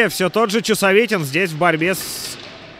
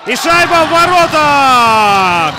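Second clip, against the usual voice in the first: high, loud, drawn-out goal shout; excited hockey goal call; 7 semitones higher, 6 dB louder, slower than usual. Real, not acted. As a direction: belt out the goal like a commentator. Commentator calls the goal.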